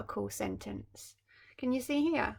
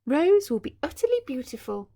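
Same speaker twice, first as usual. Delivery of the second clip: bright higher fairy, louder; 6 semitones higher, 8 dB louder than usual. Acted. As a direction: bestow the gift proudly. Fairy gives her wish.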